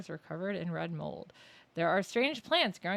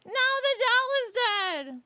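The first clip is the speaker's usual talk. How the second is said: high, slow mock wail; hammed-up horror; 9 dB louder, much slower than usual. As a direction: ham up the shock, high and slow. Mock horror at dead doll.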